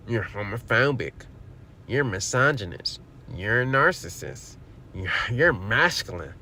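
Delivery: mild mock imitation of the accuser; mocking the woman. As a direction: sneer out her accusations like a parody. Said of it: "she has every word at her disposal to try to demonize".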